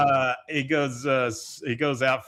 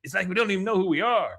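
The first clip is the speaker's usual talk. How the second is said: pitched higher and faster, earnest; higher, faster lament; Enrique voice; 6 semitones higher, faster than usual. Retold or imitated. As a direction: play the sad neighbor pouring out his heart.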